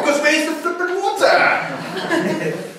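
high, slow, laughing mock outrage; drawn-out laughing complaint about the water; mock exasperation. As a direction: play up the fake frustration with a laugh. Mocking frustration with God.

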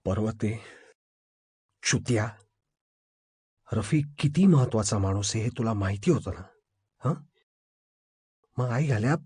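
low, quiet, seething reproach; barely controlled anger. A narrator's reading. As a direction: hold the rage down while cursing. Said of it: "अभिनी कसंबसं स्वतःला सावरलं". (राग दाबून, खालच्या आवाजात)

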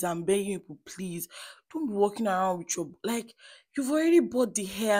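ordinary talk

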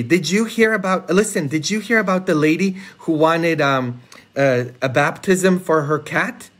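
ordinary talk

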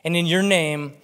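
slow, measured reading of the psalm; scripture reading. Acted. Preacher reading Psalm sixty-three aloud to the congregation, slow and earnest. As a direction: read it slowly and earnestly.

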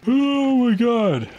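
yawning through the words; mid-yawn. Real, not acted. Yawning, high drawn out.

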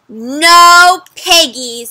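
loud, very slow flat refusal; firm no. Acted. Farmer firmly refusing the hungry witch, loud and slow. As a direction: drag it out and shut her down. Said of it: "said the farmer".

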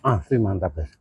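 chewing between words; muffled with food in mouth; mouth partly full. Real, not acted. Seen 0: Lips pursed and jaw working between words; he was eating beforehand.

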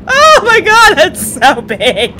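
ordinary talk, nothing marked

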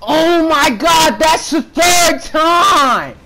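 slow, high, drawn-out yell; frustrated high outburst; exasperated shout. Real, not acted. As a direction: stretch out an outraged complaint. Exasperated at dying a third time.

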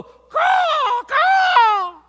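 loud, high cawing crow cry. Acted. The stones crying out like a crow, loud high caw. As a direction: screech it like a crow. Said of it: "that cry of the crow".

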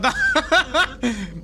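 loud, high burst of laughter. Real, not acted. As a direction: crack up after the shout. Laughs at own mozzarella cheer.

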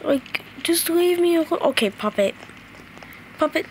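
ordinary talk, nothing marked

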